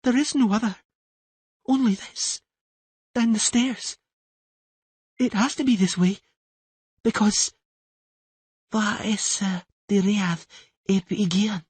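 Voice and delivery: put-on high girl's voice, unsure; a child reciting strange words; frightened young girl. Siri telling Tris the only way down.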